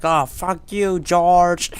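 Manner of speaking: high, loud mock yell; mock outraged retort. Palin snapping back at Bush, high mock yell. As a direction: fling it back in a shrill fake outrage. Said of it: "Be like".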